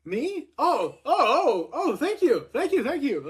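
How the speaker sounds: high-pitched, acted flattered disbelief; flustered surprise. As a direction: stammer out surprised thanks to the crowd. Retells stunned reaction to crowd's chant.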